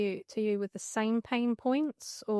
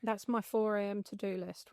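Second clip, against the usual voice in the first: ordinary talk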